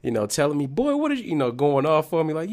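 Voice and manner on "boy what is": much higher mock mom scolding; mom yelling. Mom scolding her son from the driver's seat, high mock yell. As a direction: voice his mom going off, pitched way up. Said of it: "my mom was yelling".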